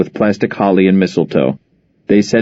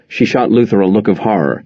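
usual speaking voice

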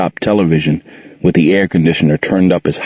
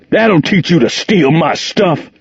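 higher, slow, furious roar; 9 semitones higher than usual. A narrator's reading. Jordan roaring at Gerald as he leaves. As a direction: roar it down at him. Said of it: "Jordan roared at Gerald".

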